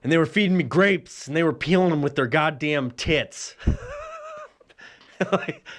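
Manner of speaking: ordinary talk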